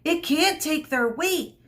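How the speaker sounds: high, urgent panic; high frightened plea; panicked. A narrator's reading. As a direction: blurt it out in fear, high. Mino panics on the tree.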